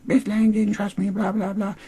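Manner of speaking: very high whiny falsetto; mock her griping in a squeaky voice; mock complaining. Host imitating Emma griping about Miss Lang, whiny falsetto. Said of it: "starts bitching about how".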